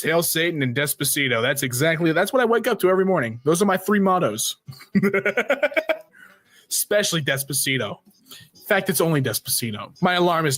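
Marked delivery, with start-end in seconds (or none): 4.9-6.0 high odd laugh. Acted. Laugh effect.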